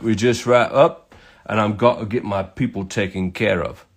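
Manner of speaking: badly faked English accent; put-on English accent, casual and matey; terrible English accent. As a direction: imitate an English bloke explaining his work. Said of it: "do my terrible English accent for this".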